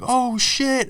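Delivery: high, shocked exclamation without extra volume; shocked onlookers. Retold or imitated. Everyone on the street sees the crash, high mock shock. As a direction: imitate stunned bystanders in a high voice. Crowd reacts to man hit by taxi.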